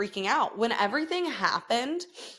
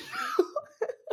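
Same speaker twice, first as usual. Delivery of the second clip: high laugh; 4 dB quieter than usual. Real, not acted. Laughs at her own joke.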